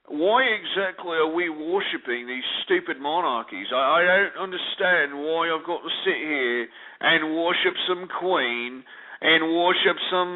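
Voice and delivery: hammed-up English accent, puzzled complaint; mock British accent. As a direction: play a baffled Brit in a fake English accent. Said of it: "these limeys, finally start realizing".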